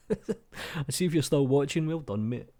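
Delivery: amused, chuckling through the words; laughing. Real, not acted. Laughs at the long video.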